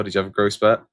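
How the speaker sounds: ordinary talk, nothing marked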